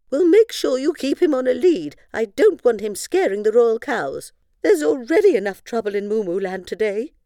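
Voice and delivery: a pompous queen giving orders; haughty queen. Acted. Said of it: "Queen Moo".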